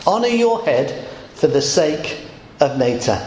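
usual speaking voice

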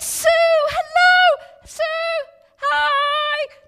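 high, loud call to a friend far off; loud, high greeting called from a hundred meters; calling out across street. Retold or imitated. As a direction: shout her name down the road.

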